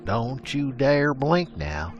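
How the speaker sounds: ordinary delivery, nothing marked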